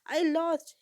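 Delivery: whimpered repeats rising into a high, loud cry; hiccuping sobs swelling to a loud wail; sobbing, building to a wail. A narrator's reading. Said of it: "whimpering, sobbing, hiccups while saying".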